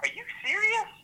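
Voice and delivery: very high-pitched retold astonishment; incredulous, pitch leaping high; shocked disbelief. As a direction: replay your stunned question, voice shooting way up. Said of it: "I was shocked".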